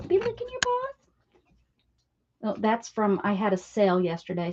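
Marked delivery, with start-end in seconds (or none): 0.0-0.9 pet talk. Real, not acted. Gently scolding Jughead for licking paws, high pet-talk voice. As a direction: chide the dog sweetly in a pet voice. Talking to the dog.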